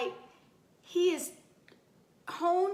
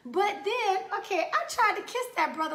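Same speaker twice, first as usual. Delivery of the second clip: ordinary talk, nothing marked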